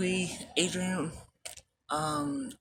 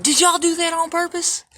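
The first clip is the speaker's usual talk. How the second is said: accusing guard; 10 semitones higher, 7 dB louder than usual. Retold or imitated.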